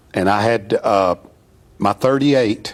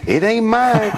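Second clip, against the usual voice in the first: high, laughing voice of the bass player; laughing denial; 10 semitones higher, slower than usual. Retold or imitated. Bass player disowning the suitcase at security, laughing. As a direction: blurt it out through a laugh.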